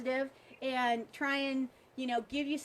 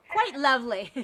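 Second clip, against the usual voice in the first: put-on British accent; 8 dB louder than usual. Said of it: "really cool British accent".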